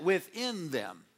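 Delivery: within them, slow and drawn out; stress on within. Shown as a demonstration. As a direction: lean on within them slowly. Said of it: "notice the way I say that".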